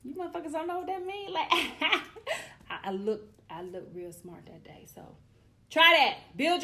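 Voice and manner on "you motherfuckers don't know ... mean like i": high, fast mocking tease; mock friends teasing. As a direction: tease them for not knowing the word. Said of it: "they was just like".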